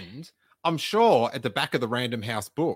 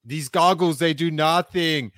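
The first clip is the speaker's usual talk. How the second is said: put-on higher, louder Austrian accent, Radioactive Man style; hammed-up Austrian accent, goggles line; 6 semitones higher, 7 dB louder than usual. Riffing a Radioactive Man quote in loud Austrian accent. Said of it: "I wanna do an accent now".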